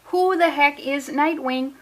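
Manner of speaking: a put-on Milwaukee accent, strong at the start; a hammed-up Milwaukee accent. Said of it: "That was a terrible Milwaukee accent, but I feel like I, I started strong".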